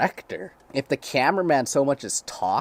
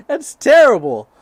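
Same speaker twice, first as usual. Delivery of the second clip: loud, high shriek through laughter; helplessly cracking up while shouting; real laughing fit, voice pitched way up; hysterical disbelief; 14 dB louder than usual. Real, not acted. Still cracking up at the twist.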